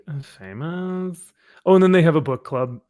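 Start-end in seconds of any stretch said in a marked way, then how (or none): 0.1-1.3 slow, drawn-out mock "famous"; deadpan mockery of "famous". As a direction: sneer the word famous slowly. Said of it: "And we're like".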